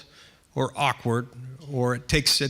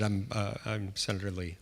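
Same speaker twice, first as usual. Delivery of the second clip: mumbled very softly, barely out; 7 semitones lower, 10 dB quieter than usual. Retold or imitated. Senator mumbling his own title to a guard. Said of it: "So I mumbled it".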